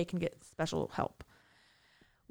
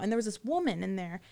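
ordinary talk

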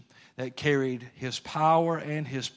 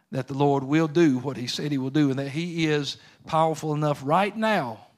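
ordinary talk

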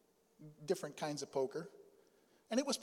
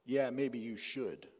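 deep tough guy voice; 5 semitones lower, slower than usual. Retold or imitated. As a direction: lower and slow, a flat threat. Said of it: "a different deep voice said".

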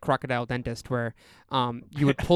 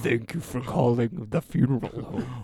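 ghoulish voice; 2 semitones lower than usual. Retold or imitated. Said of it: "like, ghoulish voice".

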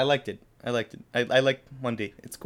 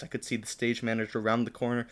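ordinary talk, nothing marked

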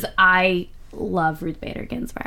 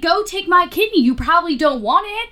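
mock panic; 6 semitones higher than usual. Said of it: "I was about to be like".

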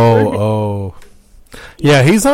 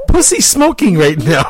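laughing bad Spanish accent; 5 semitones higher, 3 dB louder than usual. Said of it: "a really bad Spanish accent".

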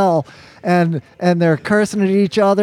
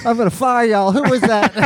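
lightly voiced higher-pitched threat; mock indignation, pitched up; imitated threatening bandleader; 7 semitones higher than usual. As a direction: mildly imitate an indignant boss, amused. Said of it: "he starts going".

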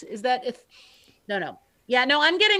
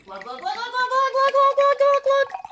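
high, rising glug glug sounds; glugging pour. Pretend. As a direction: imitate wine glugging out of a bottle. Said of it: "I'm gonna try and glug, glug, glug in the microphone".

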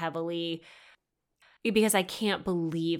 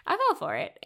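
much higher-pitched self-mockery; self-mocking admission; 10 semitones higher than usual. Real, not acted. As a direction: pitch it way up in disbelief at yourself. Third, high-pitched admission.

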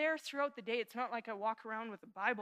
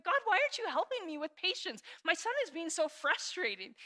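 frustrated complaint; 6 semitones higher, 6 dB louder than usual. Retold or imitated. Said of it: "she's like".

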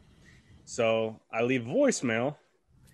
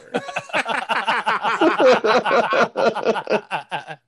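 high, loud, helpless laughter; real belly laugh that keeps going; long loud laugh; 9 semitones higher, 8 dB louder than usual. Real, not acted. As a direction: crack up completely. Cracks up at own story.

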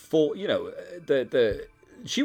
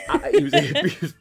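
laughing; 9 dB louder than usual. Real, not acted. Laughing at own impression.

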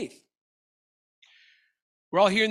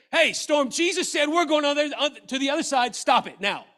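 raised-voice order to a storm; higher, forceful acted command; 7 semitones higher, 4 dB louder than usual. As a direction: command it firmly, as if rebuking it. Imagined disciples commanding the storm.

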